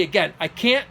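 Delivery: usual speaking voice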